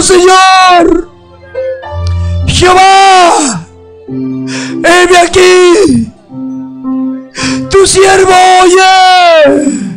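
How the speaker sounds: slow, crying cry of surrender; tearful prayer. Acted. Preacher praying before the congregation, slow tearful surrender. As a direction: drag each word out through tears.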